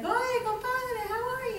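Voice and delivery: high, soft acted hello; greeting friend. Friend from Hialeah meeting the returned meditator, high-voiced greeting. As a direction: lift it high for a compadre greeting.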